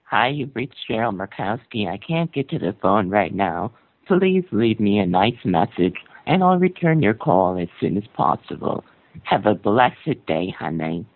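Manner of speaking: loud, sugary voicemail greeting. Pretend. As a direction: greet callers sweetly on the answering machine.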